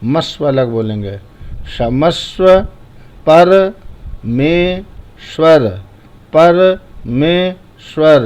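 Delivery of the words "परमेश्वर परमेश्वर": परमेश्वर slowly in three separate pieces, पर-मे-श्वर; split into three parts. Shown as a demonstration. Teacher shows pupils how to chant it, split in three. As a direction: break परमेश्वर into पर, मे, श्वर with clear pauses. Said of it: "तीन बार बोलेंगे".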